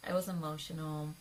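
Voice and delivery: normal talking voice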